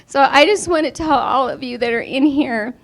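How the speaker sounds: emotional thank-you choking up; voice breaking with emotion. Real, not acted. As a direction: fight back tears while thanking them. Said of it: "I still get really emotional".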